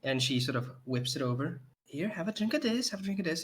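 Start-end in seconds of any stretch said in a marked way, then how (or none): 1.8-3.4 coaxing. Acted. Innkeeper coaxing drunk guy.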